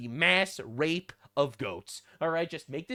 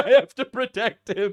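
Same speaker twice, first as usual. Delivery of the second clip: laughing; 4 semitones higher, 7 dB louder than usual. Real, not acted. Laughing mock of cop.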